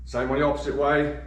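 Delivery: ordinary talk, nothing marked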